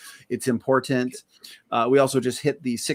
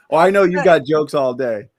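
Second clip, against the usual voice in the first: laughing tease; 4 semitones higher, 7 dB louder than usual. Real, not acted. Teasing the joker.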